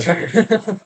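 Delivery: a brief rising chuckle; light laugh. Real, not acted. Student chuckles after joking that the university made him cry, brief laugh. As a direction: chuckle at your own joke.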